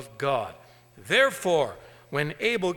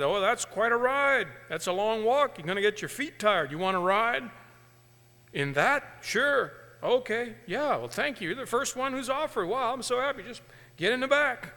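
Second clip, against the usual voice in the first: cheerful farmer; 6 semitones higher than usual. Acted. Farmer voiced in story.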